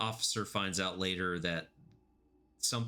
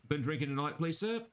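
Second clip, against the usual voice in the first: mock Aussie cop; 7 semitones higher than usual. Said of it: "it has an Aussie accent".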